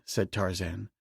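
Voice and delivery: ordinary delivery, nothing marked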